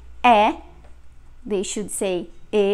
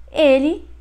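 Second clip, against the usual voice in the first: ordinary talk, nothing marked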